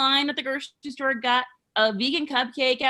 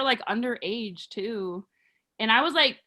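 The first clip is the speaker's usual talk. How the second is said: ordinary talk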